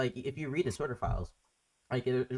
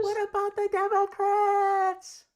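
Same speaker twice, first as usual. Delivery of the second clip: drawn out in a high, mocking singsong; mocking whine; 6 dB louder, much slower than usual. Host parroting callers' whataboutism in a slow, high, mocking singsong. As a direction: stretch it out slowly, parroting them.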